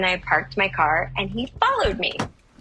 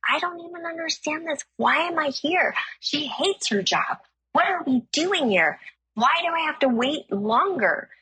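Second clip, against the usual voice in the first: high-pitched, whiny venting; exasperated complaining; 8 semitones higher than usual. Retold or imitated. Actor vents to a friend at an audition, exasperated. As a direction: imitate griping, pitched well up.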